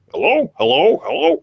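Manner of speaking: mimicking his own echo coming back. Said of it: "my voice come back and be like".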